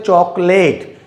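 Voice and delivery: चॉकलेट ending in a full "late"; चॉकलेट with a long ay in the last syllable; mistaken pronunciation. Shown as a demonstration. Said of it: "it's not chocolate".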